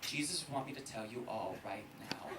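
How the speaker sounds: hushed mock-homily delivery; re-enacted solemn confession. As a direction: address the congregation gently, building to a reveal. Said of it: "I said in front of a thousand".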